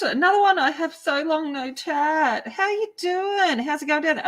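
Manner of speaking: high, bright excited hello to a viewer; excited greeting. Real, not acted. Painter greeting Belinda in chat, high and excited.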